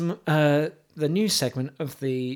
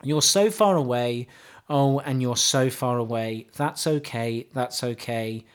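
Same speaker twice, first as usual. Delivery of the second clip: mock poetry recital; 4 semitones lower than usual. Host reciting pop lyrics to co-host, mock solemn. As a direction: recite it like deep poetry, deadpan.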